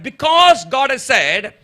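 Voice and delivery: "because God has said" drawn out slowly and high; preacher underlining "because God has said"; underlining the words. Real, not acted. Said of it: "underline the word".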